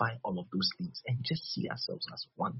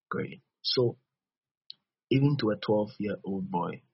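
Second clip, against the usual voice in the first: ordinary talk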